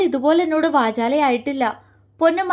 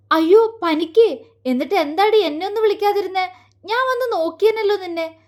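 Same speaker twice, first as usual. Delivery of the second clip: higher, fast worried questions; alarmed concern; 6 semitones higher than usual. A narrator's reading. Friend fretting over the sick girl, alarmed and higher. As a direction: rush out the worry, pitched up. Friend hears Jwala had fever.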